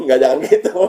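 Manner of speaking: laughing while brushing off the idea. Real, not acted. Teacher laughing off staged crying for class, amused. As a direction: chuckle through the dismissal.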